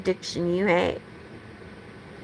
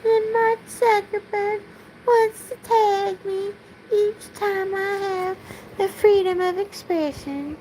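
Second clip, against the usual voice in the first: much higher put-on Southern belle, held throughout; 8 semitones higher, 4 dB quieter than usual. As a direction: keep the high-pitched belle voice going through the rant. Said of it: "I still have to speak like a Southern belle".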